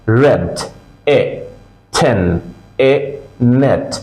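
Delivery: isolated short vowel, pause, then its word, like ই, লিড; slow, spaced model words echoing each short ই or এ; vowel drill. Shown as a demonstration. Teacher drills vowel then word, ই, লিড, for learners. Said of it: "আমি যে সাউন্ডটা করছি".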